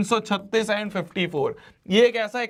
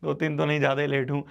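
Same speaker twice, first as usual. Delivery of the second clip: usual speaking voice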